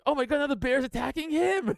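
high-pitched pretend alarm; comic play-by-play of terror; mock panic. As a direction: exclaim like a horrified onlooker, hammed up. Voicing victim narrating bear attack.

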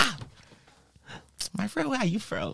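a light Nigerian accent, playing the local boys; high friendly Nigerian accent from the boys at the window. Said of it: "I don't wanna put on a Nigerian accent".

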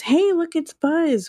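high excited cry; excited exclaim. Retold or imitated. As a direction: squeal it out, high and thrilled. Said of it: "Rex exclaims".

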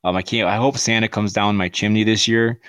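ordinary talk

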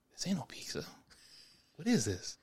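hushed disbelieving whisper. Retold or imitated. Retold shock at fake pizza.

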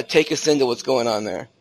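usual speaking voice

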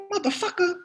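high, incredulous star impression; Sam Jackson. Star stopping a nervous young actor in rehearsal, incredulous. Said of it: "he looks at me, he goes".

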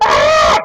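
short breathy mock scream, loud; imitating the girls' scream at the activist; retold scream. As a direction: shriek like startled girls. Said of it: "they stood up and they screamed".

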